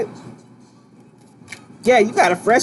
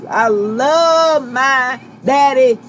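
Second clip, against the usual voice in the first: drawn-out loving; 3 semitones higher, 3 dB louder, much slower than usual. Real, not acted. Reader gushes about her father, slow and loving. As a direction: stretch "I love my daddy" slowly and fondly.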